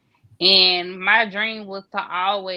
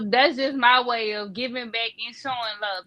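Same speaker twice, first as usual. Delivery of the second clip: ordinary delivery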